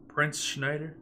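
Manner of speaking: "Prince Schneider?" asked softly with a rise; soft aside question. Acted. King quietly turning to the prince, questioning. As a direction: drop your voice and ask it.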